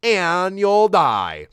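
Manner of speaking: high, drawn-out doom; hammed-up dire warning; dramatic warning. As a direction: stretch the last words out slowly and high. Voicing Steven's dire warning, drawn out.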